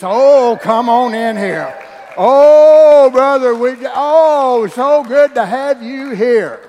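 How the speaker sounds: hammed-up loud church greeter; exuberant greeting. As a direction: throw your arms wide and shout the welcome.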